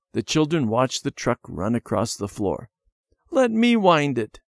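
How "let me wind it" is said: put-on high-pitched child's plea; begging. A narrator's reading. Debbie to Randy over his toy truck, begging. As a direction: beg in a high little girl's voice. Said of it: "Debbie begged".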